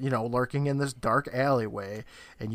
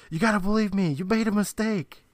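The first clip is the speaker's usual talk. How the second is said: higher-pitched mock pleading yell; retold yell; 7 semitones higher than usual. Recapping Chris dragged to the door, mock yelling. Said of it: "as he yells out that".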